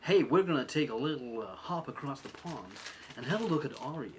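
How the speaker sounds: low, soft bad American accent; awful American accent. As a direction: put on a badly faked American voice. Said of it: "That's my awful, awful American accent".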